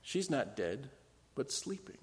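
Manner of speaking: Jesus quietly comforting the grieving family; gentle comfort. Acted. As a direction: soothe the crying mourners. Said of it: "And he says".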